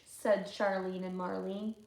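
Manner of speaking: usual speaking voice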